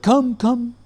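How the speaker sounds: high-pitched calling out; retold high, urgent beckoning; imitated summons. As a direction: call them over in a high voice, quoting him. Guru leaps up, calls them.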